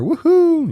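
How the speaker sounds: loud high "woohoo"; whoop. Real, not acted. Cheering in the live stream.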